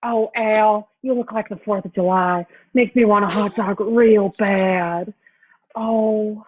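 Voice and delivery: slow, lingering delivery of a comic impression; Jennifer Coolidge. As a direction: stretch the words out in a put-on voice. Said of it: "give you a little Jennifer Coolidge".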